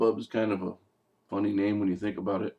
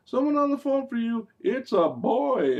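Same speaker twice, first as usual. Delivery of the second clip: very high singsong teasing call; mock high-pitched announcement; housemate calling up; 13 semitones higher than usual. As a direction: tease her in a put-on high girly voice. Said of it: "Someone from downstairs said".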